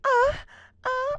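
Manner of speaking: loud, high mock cries; high background yelps. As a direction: throw out short rowdy yelps. Mimics noises behind the call.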